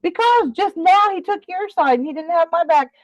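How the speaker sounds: high, emphatic grievance; indignant complaint. Real, not acted. Woman accusing Alan of taking sides, indignant. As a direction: argue back high and insistent. Argues why Alan is in trouble.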